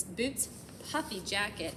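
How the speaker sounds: ordinary delivery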